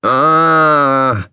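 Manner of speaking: drawn-out startled "ah"; long waking yelp; startled yell. A narrator's reading. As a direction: cry out on being jolted awake. Startled awake by the holler.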